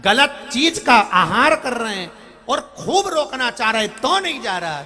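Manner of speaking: loud, high, driving sermon; full-force preaching. Real, not acted. Preacher admonishing Hindus who eat meat, full-force preaching.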